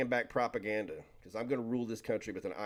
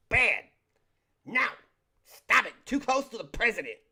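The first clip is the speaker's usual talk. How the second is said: higher, louder bodyguard reprimand; mock scolding; 10 semitones higher, 5 dB louder than usual. Pretend secret police scolding a fan too close to the president, high and loud. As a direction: shoo them off like a stern guard.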